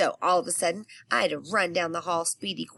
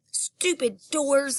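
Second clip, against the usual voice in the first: hollering; 7 semitones higher, slower than usual. Acted. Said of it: "I hollered".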